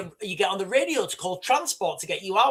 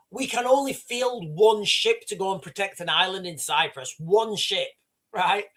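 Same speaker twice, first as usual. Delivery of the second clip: ordinary talk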